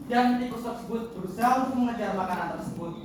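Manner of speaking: ordinary delivery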